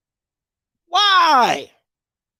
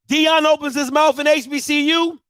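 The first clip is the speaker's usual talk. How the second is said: normal talking voice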